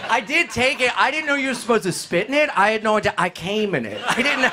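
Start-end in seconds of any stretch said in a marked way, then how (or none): none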